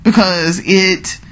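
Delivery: usual speaking voice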